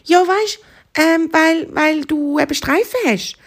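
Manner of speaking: high, hesitant hare voice; little hare. Acted. Hare justifies itself to the zebra, high and hesitant. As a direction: explain it haltingly in a high little voice.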